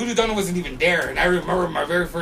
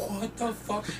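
hushed, softer stunned reaction; stunned disbelief; 3 semitones higher, 11 dB quieter, slower than usual. Retold or imitated. Watching him smash a window, hushed disbelief. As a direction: drop the volume as if dumbstruck.

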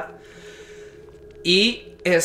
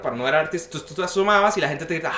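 ordinary delivery

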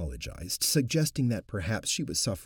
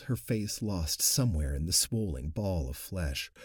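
ordinary delivery, nothing marked

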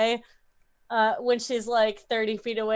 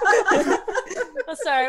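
laughing apology; 10 semitones higher, 7 dB louder, much slower than usual. Real, not acted.

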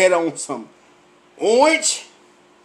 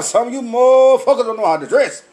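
ordinary talk

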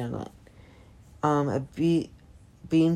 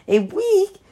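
shrill high wail of disbelief; a loud high cry, near panic; 7 dB louder than usual. A narrator's reading. A girl wails at the thought of school bald, loud and high. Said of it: "Stina wailed".

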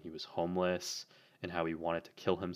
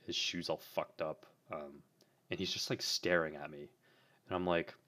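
ordinary talk, nothing marked

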